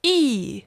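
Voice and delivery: E falling sharply; fourth tone. Shown as a demonstration. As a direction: snap E straight down. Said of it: "the four tones".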